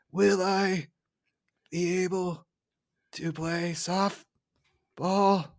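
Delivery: very slow, high, soft voice; drawn-out sickly imitation. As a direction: stretch each word out with long pauses. Imitating radiation-poisoned player, slowly.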